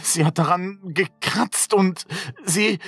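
high-pitched, halting fear; panicked. Acted. Child heard her scratching behind the door, panicked. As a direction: blurt it out in mounting terror, faltering.

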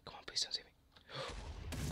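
hushed whisper so he won't notice; tense whisper, not to be seen. Real, not acted. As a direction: breathe it, hiding. Hiding from enemy player.

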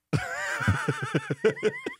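very high, soft laugh; laughter. Real, not acted. Host tickled by co-host answering yes, high soft laughter. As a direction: squeal softly with laughter.

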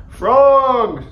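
high, loud, drawn call for a friend; searching call. A narrator's reading. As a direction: call out for someone who has vanished. Toad calls for missing Frog.

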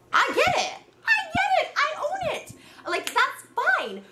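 high mocking "fine"; sarcastic shrug. Real, not acted. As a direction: toss it off with sarcasm.

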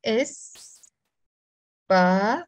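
"Es, pa" as two separate syllables, pause between; teacher's spaced-out "Es... pa". Shown as a demonstration. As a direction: sound out Es, then pa. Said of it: "we don't have a P".

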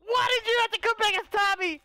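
high, indignant yelling; angry outburst. Pretend. Player cursing Ava for returning as Tommy, angry high yell. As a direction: rage at them with pitch shot up.

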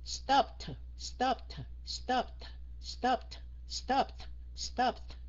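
STOPPED repeated as "stopt", audible T. Shown as a demonstration. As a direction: keep popping the final T in Stopped. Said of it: "Mình cứ đọc như vậy". (Stopped, bật âm T ở cuối)

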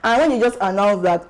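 usual speaking voice

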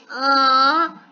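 usual speaking voice

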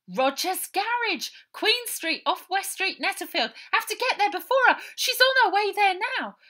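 put-on a little girl blurting it out in a hurry; urgent child's voice. Baby urgently gives address.

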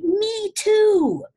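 hammed-up dramatic shriek; mock despairing shriek. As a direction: wail it in mock tragedy. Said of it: "And I'm like".

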